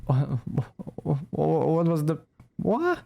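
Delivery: stuttering disbelief, rising high at the end; baffled stammer. Real, not acted. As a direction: stumble over it in confusion, ending on a high questioning 'what'. Baffled reaction to the game's dialogue.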